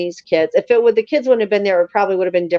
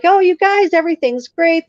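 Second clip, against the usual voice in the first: high, louder sing-out of false cheer; coaxing; 8 semitones higher, 4 dB louder than usual. Retold or imitated. Grandmother to terrified kids up a tree, coaxing brightly. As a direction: reassure frightened children in a much higher voice. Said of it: "trying to coax the kids down".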